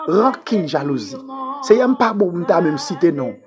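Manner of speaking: usual speaking voice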